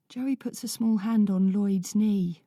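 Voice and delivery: ordinary delivery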